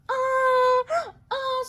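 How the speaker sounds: high excited gasp then sorry; a real burst of glee, very high; excited squeal. Real, not acted. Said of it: "I get all excited".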